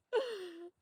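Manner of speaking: loud, high startled gasp; sharp gasp. Real, not acted. Streamer discovering the bounty hunter's identity, sharp loud gasp. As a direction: suck in a breath of shock.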